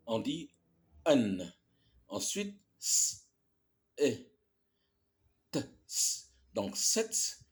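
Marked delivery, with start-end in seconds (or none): none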